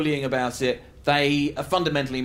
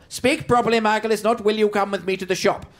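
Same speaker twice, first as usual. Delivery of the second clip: pedantic auntie; 6 semitones higher than usual. Retold or imitated. Auntie correcting young Michael's grammar, prissy and shrill. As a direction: scold like a fussy aunt fixing grammar. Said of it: "You say".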